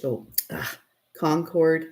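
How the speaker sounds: usual speaking voice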